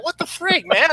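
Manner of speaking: high, incredulous mock complaint; exasperated Thor. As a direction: play Thor fed up and baffled. Thor reacting in retold scene.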